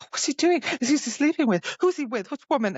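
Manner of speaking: high-pitched, prying jealous questions; hammed-up jealous interrogation; mock jealous. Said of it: "jealous again".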